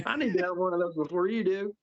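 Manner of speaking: high-pitched put-on Southern drawl; mock demand in a high Southern accent; [name]'s Southern drawl. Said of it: "Southern drawl now".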